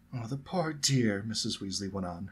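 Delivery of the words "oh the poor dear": slow, higher motherly sympathy; full of concern. A narrator's reading. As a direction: draw it out with tender pity. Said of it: "her voice full of concern".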